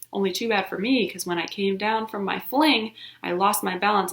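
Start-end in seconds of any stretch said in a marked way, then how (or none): none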